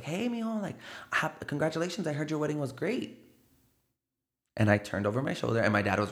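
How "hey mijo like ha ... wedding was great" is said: high, soft, sweet mock greeting; lightly voiced fake-nice relative; sugary fake-friendly relative. Relative greeting him at a birthday, sweetly high.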